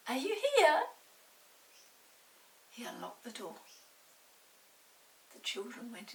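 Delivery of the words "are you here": high, loud call into the room. A narrator's reading. Peter calling for the pixie through the door, loud call. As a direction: call his name across the shed. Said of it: "he called".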